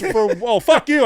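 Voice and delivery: high, loud retort, imitating the driver; retold comic outburst; mock outburst. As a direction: jump up in pitch and volume on the comeback. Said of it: "he's like, he goes".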